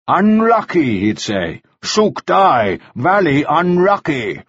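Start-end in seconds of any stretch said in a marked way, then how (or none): 0.1-1.0 high mock Chinese-English voice; mock Chinese accent. A narrator's reading. Mannering mimics Ah Kui to Ah Suk, mock Chinese accent. Mimics what the friend would say. 1.8-4.4 higher, mocking Chinese-English voice; mock Chinese accent. A narrator's reading. Mannering mimics Ah Kui to Ah Suk, mock Chinese accent. Mimics what the friend would say.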